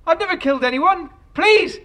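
high, loud and fast; panicked pleading. Acted. Man accused of murder, pleading high and loud in panic. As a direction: beg in panic.

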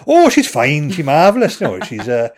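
chatting to grandmother in broad Welsh; old Welsh accent. Retold or imitated. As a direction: slip back into your old Welsh accent. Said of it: "I just slip straight back into it".

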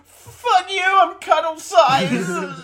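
loud strained yell, high and slow; shouted. Retold or imitated. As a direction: yell it, voice cracking. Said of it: "exactly like that".

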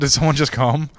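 ordinary talk

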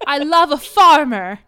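high, loud, hammed-up wail; mock sobbing. Distressed sister confessing to the heroine, mock sobbing. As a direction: sob it out in comic despair. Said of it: "she's, like, sobbing".

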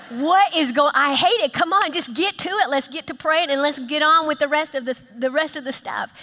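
loud, high mock outburst of impatience; exasperated man. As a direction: act fed up and hurry everyone along loudly. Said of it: "had a man been in that room".

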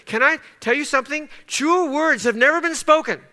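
high, loud climactic preaching; preacher pressing the point home; preaching at full force. Acted. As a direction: build to a fervent peak.

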